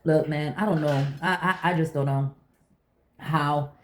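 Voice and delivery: ordinary talk, nothing marked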